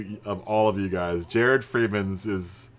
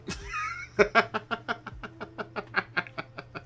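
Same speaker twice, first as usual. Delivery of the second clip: breathy high laugh; 13 semitones higher, 6 dB quieter than usual. Real, not acted. Laughs at own Irish-accent question.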